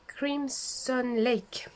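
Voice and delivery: Crimson Lake said slowly with a French accent, each syllable drawn out; French-accented English. Real, not acted. Showing viewers her paint, a slow French-accented Crimson Lake. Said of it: "Désolée pour mon anglais".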